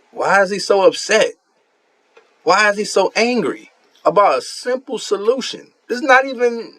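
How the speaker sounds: high-pitched disbelief all through; can't believe how mad he is; incredulous. Real, not acted. Streamer baffled by his opponent's anger, voice pitched high.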